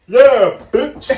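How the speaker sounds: high loud mocking yell; shouting. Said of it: "I'm like".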